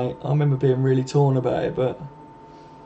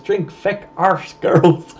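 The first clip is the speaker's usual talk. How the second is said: put-on slow, higher-pitched comic Irish accent; 4 semitones higher, much slower than usual. Streamer quoting a sitcom to chat in a jokey Irish accent. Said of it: "your Irish accent is brilliant".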